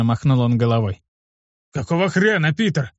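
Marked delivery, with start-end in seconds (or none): 1.7-2.9 raised pitch, contained indignation; a touch of exploding at the boss; mild outburst. A narrator's reading. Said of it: "взорвался Олег". (сдержанная вспышка возмущения)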